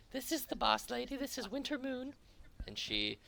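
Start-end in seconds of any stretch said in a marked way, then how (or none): 0.1-2.1 very high, quick little cat creature voice; high squeaky cat voice. Acted. GM voicing the little cat guide pointing out the boss, squeaky high. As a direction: squeak it out like a tiny excitable cat. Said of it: "And he looks at you guys".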